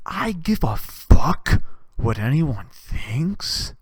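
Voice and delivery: slow, breathy whisper. Acted. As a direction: whisper it slowly like a secret. Whispered aside in a rant.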